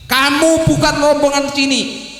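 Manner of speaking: high, fast command; stern angel. Acted. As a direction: order him out like a heavenly guard. Angel rejects Samnun.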